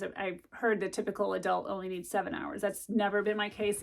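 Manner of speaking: usual speaking voice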